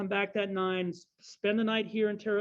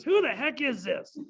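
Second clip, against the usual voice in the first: higher-pitched, imitated irate demand; mock indignant; 5 semitones higher than usual. Ed Asner demands to know who's phoning, mock indignant. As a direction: challenge an unknown caller, play-acting. Gruff friend answers unknown caller.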